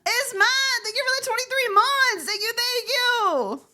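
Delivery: very high, squealed thank-you; high-pitched thanks. Real, not acted. Streamer thanks a member for months of support, high squeal. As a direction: push it way up high, stretch the last words.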